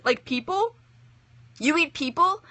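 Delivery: put-on startled little girl.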